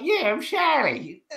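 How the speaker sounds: high, slow put-on voice; drawn-out, high-pitched mock introduction; celebrity's mother. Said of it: "She's like".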